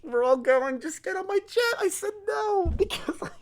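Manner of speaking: silly high mock voice of the jet owner. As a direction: squeak his words back in a silly high voice. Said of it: "He's like".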